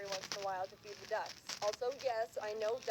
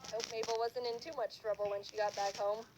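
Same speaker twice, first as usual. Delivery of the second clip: usual speaking voice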